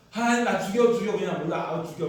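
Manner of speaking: high, loud, melodramatic complaint; petulant tantrum. Acted. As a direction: whine to God in hammed-up despair. Jonah acted throwing tantrum at God. (하나님께 떼쓰며 크게 투정하듯)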